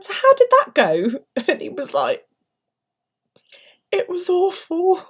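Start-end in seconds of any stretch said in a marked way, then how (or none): 0.0-1.2 higher, louder and slow, mock innocent; prying question. Mum in labour quizzing the nervous doctor: slow, higher, sweetly nosy. 3.9-5.0 high, slow, pitiful doctor's wail; mock wail. Young doctor confessing to the mum: slow, much higher pitiful wail. Doctor admits the last birth.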